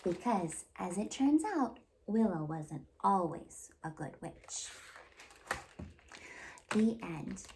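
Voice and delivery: put-on lively, expressive read-aloud for little kids; storytime narration. A narrator's reading. Children's librarian reading a fairy tale to kids, lively storytelling.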